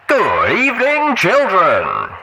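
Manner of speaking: loud, high showman DJ voice; big, brash radio host greeting his listeners. Acted. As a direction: boom it out like a wasteland radio host. DJ Pon3 radio broadcast.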